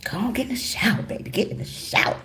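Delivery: breathy teasing whisper; seductive whisper. Retold or imitated. Wife teasing her husband into the shower, whispering. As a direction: coax him softly, almost inaudible.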